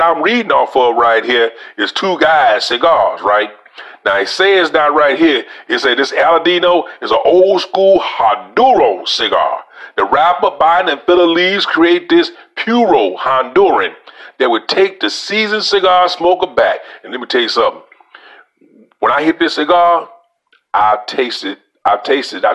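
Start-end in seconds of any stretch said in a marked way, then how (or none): 6.0-16.8 higher, slower read-aloud voice, announcing the text. Acted. Reviewer reading a cigar website to viewers, raised reading voice.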